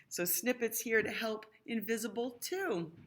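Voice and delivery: usual speaking voice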